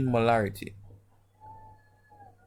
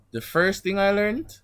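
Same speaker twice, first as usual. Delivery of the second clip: ordinary delivery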